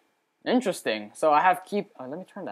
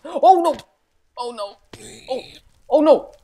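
high, loud yelps of alarm; startled, pitched-up outburst; panicked; 9 semitones higher, 10 dB louder than usual. Real, not acted. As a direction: cry out in sudden panic. Sudden danger in the game.

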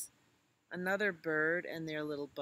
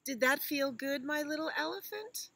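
mother elephant voice; 10 semitones higher, 5 dB louder than usual. Acted. Mother asks child.

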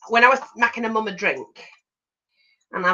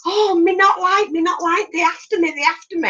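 put-on frightened toddler; 10 semitones higher than usual. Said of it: "all of a sudden she went".

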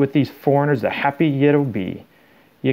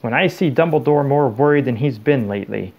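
usual speaking voice

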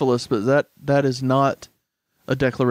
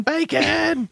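high squealed bacon; shrill mock yell of bacon; mock scream; 14 semitones higher than usual.

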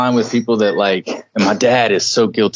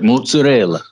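put-on mozzarella in a showy Italian accent, higher pitch; 4 semitones higher than usual. Mocking food snobs, mozzarella in a put-on Italian accent. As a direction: overdo the Italian accent, "mootsa-RELLA". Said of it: "put an accent on mozzarella".

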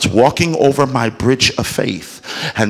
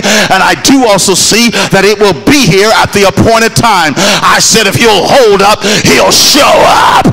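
rising, louder and higher shouted preaching; preaching at full force; 8 semitones higher, 7 dB louder than usual. Real, not acted. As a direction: build the declaration into a full shout. Preaching climax over music.

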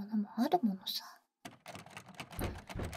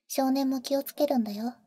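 normal talking voice

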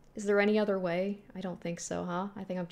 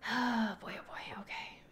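nervous whisper; 3 semitones higher, 6 dB quieter than usual. Real, not acted. Wary player facing a creepy room, whispered dread.

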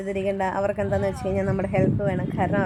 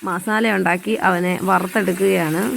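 vlog narration; 2 semitones lower, 7 dB louder than usual. Acted. Narration over cooking.

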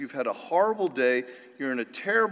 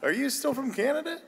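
high, disbelieving question; incredulous question; 9 semitones higher than usual. Retold or imitated. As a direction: ask it in mock disbelief. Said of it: "I'm like".

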